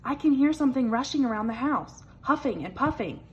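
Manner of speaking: small worried mouse voice; little mouse telling his fears; a hint of little mouse. A narrator's reading. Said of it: "cried Little Mouse".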